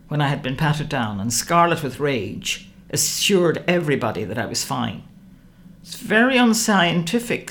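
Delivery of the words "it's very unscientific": higher, louder sniffy dismissal; lightly voiced doctor scoffing at the trick. A narrator's reading. The doctor at the table scoffs at Alice's method, sniffy. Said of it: "said the doctor".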